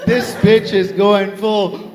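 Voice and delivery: ordinary delivery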